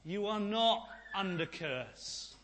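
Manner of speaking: ordinary talk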